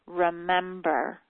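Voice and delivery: "Remember," drawn out slowly; slow demo word. Shown as a demonstration. Coach slowly showing how to start the PS, "Remember,". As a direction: stretch out "Remember," as a sample opener. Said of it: "you start the sentence".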